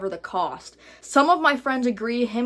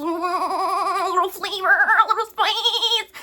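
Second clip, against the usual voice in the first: high, slow, laughing sing-song; hammed-up giddy delivery; playful sing-song; 9 semitones higher, 4 dB louder, much slower than usual. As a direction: stretch it out, teasing and giddy. Playfully hamming up wanting spice.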